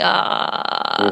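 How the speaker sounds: breathy rasp from the throat; raspy morning voice. Shown as a demonstration. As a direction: rasp it out like just waking up. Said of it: "this raspy voice".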